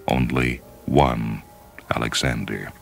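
usual speaking voice

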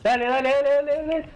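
excited urging. Real, not acted. Urging teammate on mid-game.

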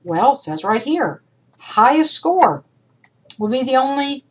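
normal talking voice

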